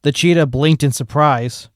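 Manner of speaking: ordinary delivery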